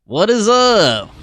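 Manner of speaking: loud, high, long-drawn hype greeting; slow, sing-out shout-out; excited greeting. Real, not acted. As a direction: stretch the names out to hail a friend. Streamer greets arriving viewer by name.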